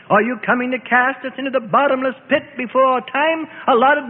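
high, insistent demon's outcry; pleading, defiant spirit calling out high; crying out. A narrator's reading. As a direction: cry out in protest, pitched well up. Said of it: "The chief spokesman spirit cried out".

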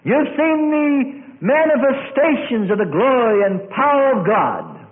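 high, loud sermon cry; impassioned preacher's shout; full-force preaching. Real, not acted. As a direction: proclaim it to the whole congregation.

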